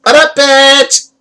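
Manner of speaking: put-on high, loud, drawn-out Valley Girl call; squealed mock promo greeting. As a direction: throw it out as a hammed-up Valley Girl. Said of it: "Valley Girl, like".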